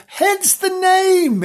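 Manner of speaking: high, slow sing-song; high drawn-out. Shown as a demonstration. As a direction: stretch it out up high. Intro repeats title phrase playfully.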